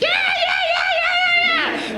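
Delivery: shrieking imitation of a wild classroom; very high mock kiddie cheering; rowdy kids. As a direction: squeal like a pack of rowdy children. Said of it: "Little bad kids".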